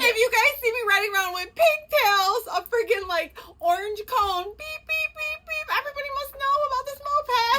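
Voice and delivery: retold giddy squeal with high beeps; giddy excitement. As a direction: squeal with joy like a happy kid. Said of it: "I was like".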